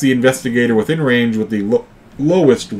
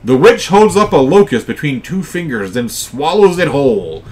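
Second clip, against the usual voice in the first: higher, louder game master announcing an attack; dramatic narration; 5 semitones higher, 6 dB louder than usual. Acted. Game master describing the witch's attack to players, dramatic narration. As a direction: launch the monster's attack with relish.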